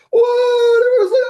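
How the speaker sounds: high wail of astonishment; stunned crowd. Acted. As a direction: imitate spectators erupting. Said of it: "like, crazy upset".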